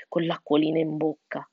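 plain reading voice

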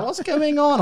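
very high squeaky what's going on; high-pitched mock bewilderment; exasperated question. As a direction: shriek the question in mock panic. Quoting self to sister-in-law.

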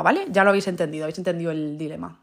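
ordinary talk

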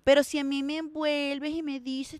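ordinary delivery

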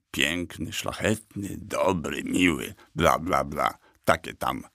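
quiet, dismissive listing; scornful. A narrator's reading. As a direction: rattle off virtues with low, bored contempt. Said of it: "powiedział to jakby z pogardą".

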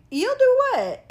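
high, loud disbelieving squeal; shocked disbelief. Retold or imitated. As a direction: react like you can't believe it. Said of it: "I was like".

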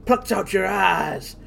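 slow, gloating backwoods villain; creepy hillbilly villain. Acted. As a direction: savor each gruesome step slowly. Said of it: "Go for his lines".

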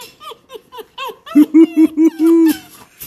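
loud, very high pulsing laugh; high-pitched gleeful cackle at a dog; high excited laugh. Real, not acted. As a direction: squeal with laughter, rising and loud.

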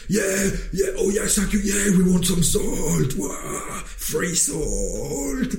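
high, eager mock delight, slowing on the salt; mock eager crowd. Indian people gladly taking free salt, mock eager. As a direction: play the grateful people, hammed-up. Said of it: "would have been like".

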